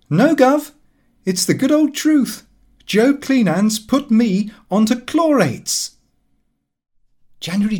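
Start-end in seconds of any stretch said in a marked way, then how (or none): 0.1-5.9 much higher, louder brag; cocky insistence. Acted. Lupin assuring his father, high, loud and cocky. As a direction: insist it's true with a grin.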